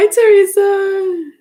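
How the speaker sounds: high, slow, delighted hello to a late viewer; drawn-out greeting. Real, not acted. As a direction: sing the name out long and bright to welcome her. Greeting a viewer who joined.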